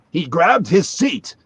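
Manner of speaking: mock solemn narrator, drawn out; Heston narrating. Heston narrating over the ad guy, slow and grand.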